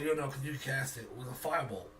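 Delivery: normal talking voice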